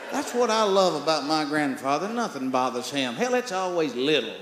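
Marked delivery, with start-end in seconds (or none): none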